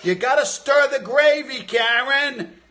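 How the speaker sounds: high, loud yelling at Karen; exasperated shout about the gravy; nagging partner. Retold or imitated. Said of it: "Goodfellas Karen bit again".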